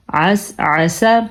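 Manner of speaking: عسا said slowly with a throaty ain; clear ain. Shown as a demonstration. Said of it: "اس عین کو ادا کرنے کا ضرور خیال رکھیں".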